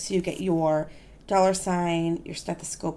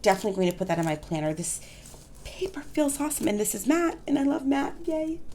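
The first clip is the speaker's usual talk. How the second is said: ordinary talk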